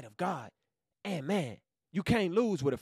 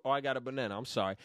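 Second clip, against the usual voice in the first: ordinary talk, nothing marked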